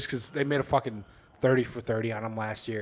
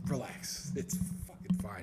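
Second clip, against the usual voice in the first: hushed reassurance; 9 dB quieter than usual. Retold or imitated. Retold reply to coworker.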